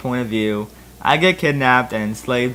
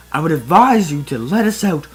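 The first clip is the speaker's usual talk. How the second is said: mock scream; 4 semitones higher, 5 dB louder than usual. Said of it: "Mace Windu screamed".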